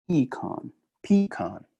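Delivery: teacher demos "pecan" the Northeast way: PEE-kahn; northeastern pecan. Shown as a demonstration. Teacher shows the class how the Northeast says "pecan", PEE-kahn. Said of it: "lo dicen como".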